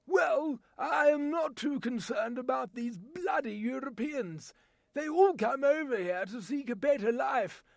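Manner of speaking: indignant British English accent; a grumpy Englishman moaning about Europeans. Shown as a demonstration. An Englishman complaining about immigrants, in an English accent. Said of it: "my voice talent demonstration".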